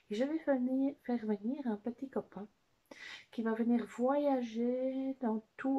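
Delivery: put-on high, lively puppet voice for children; Watson. Watson explains today's exercise to the children, high animated puppet voice. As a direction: play the cheerful companion, high and loud in bursts. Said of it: "Watson va nous expliquer un petit peu".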